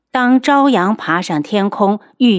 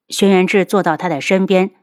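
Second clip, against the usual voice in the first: plain reading voice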